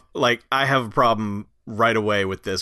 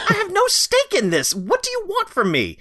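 high, fast, exasperated whine; whiny race car driver. Retold or imitated. The race car driver protesting to the family, high and whiny. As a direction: whine it like he's been dragged into this.

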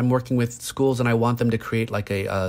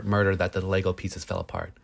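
usual speaking voice

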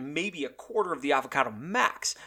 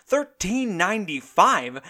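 slow, higher, stunned repeat of the price; higher, drawn-out outraged disbelief; incredulous echo; 4 semitones higher, 4 dB louder, slower than usual. Acted. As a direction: say it like you can't believe your ears.

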